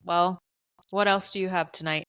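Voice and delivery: ordinary talk, nothing marked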